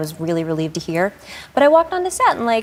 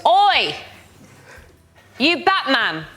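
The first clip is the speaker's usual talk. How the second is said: put-on Cockney boy; 7 semitones higher, much slower than usual. Boy calling to the Batman star, mock Cockney shout. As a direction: imitate a Cockney lad hollering across the street. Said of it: "I think it's a Cockney accent".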